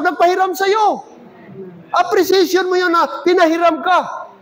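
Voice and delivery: high-pitched, insistent preacher's lecture; animated preaching exhortation. Real, not acted. Preacher tells congregation how to return borrowed things, high and insistent.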